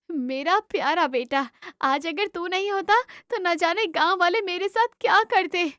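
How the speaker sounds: emotional mother, pitch climbing as she goes on; grateful loving mother. Acted. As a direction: praise your son with heartfelt relief. Grateful mother praises son.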